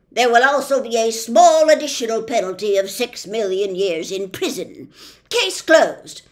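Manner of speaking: loud, slowish officious policeman; pompous policeman. Acted. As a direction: lay down the law with bluster. Judge sentences.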